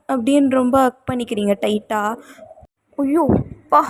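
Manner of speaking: ordinary delivery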